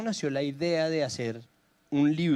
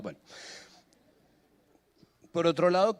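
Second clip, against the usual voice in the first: ordinary delivery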